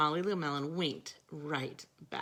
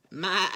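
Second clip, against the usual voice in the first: louder goat maa; mock goat bleat; 6 dB louder than usual. A narrator's reading.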